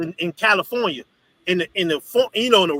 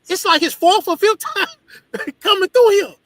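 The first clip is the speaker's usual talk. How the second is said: amazed disbelief; 9 semitones higher than usual. Retold or imitated. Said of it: "I'm like, "Damn".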